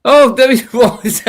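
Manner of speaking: high, loud, laughing and slow; laughing surprise. Real, not acted. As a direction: laugh out the discovery. Ken appears on cue.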